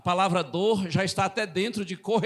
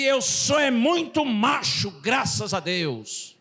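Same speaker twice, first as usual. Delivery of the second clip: higher, slow, emphatic comeback; proud retort; 3 semitones higher, slower than usual. Retold or imitated. Younger brother answering a taunt, proudly defiant. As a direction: fire back with wounded macho pride.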